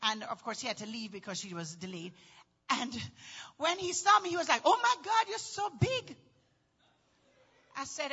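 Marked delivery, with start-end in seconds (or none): 4.6-6.2 high, mildly gushing mock surprise; amazed exclamation. Dad seeing his pregnant daughter, imitated amazement. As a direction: imitate a startled dad's exclamation.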